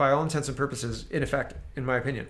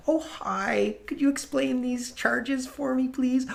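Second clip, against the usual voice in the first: much higher, slow, sugary timid asker; mock polite customer; 10 semitones higher, slower than usual. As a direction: play the meek complainer who never pushes back. Mocking overly polite complainant.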